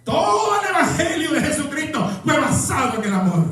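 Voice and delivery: high-pitched, driving sermon delivery; predicación enérgica. Acted. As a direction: preach it hard, pushing the pitch up. Preaches at full force. (prédica encendida)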